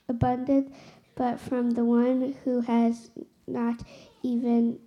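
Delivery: ordinary delivery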